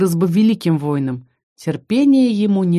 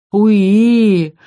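drawn-out, louder sad little squeal; mournful squeal; 3 semitones higher, 6 dB louder than usual. Acted. Little dragon mournfully squeals on her lap. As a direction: whimper it out longingly. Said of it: "очень тоскливо протянул Драк".

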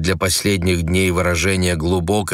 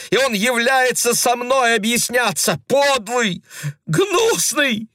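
very high, furious cries; woman raging in the hallway in powerless fury; impotent rage. A narrator's reading. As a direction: rail helplessly at the neighbours. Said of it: "В бессильном бешенстве кричала Лёля".

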